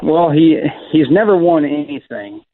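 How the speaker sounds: usual speaking voice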